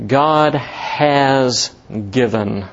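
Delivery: GOD weighted, the whole phrase very slow; stress on GOD. Shown as a demonstration. Preacher pointing the congregation to GOD, very slow stress. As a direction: lean on GOD and draw out has given. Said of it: "Notice the emphasis here".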